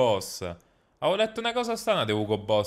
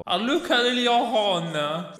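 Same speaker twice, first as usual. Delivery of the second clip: imitating the game character; 7 semitones higher, much slower than usual. Fa il verso alla battuta del gioco.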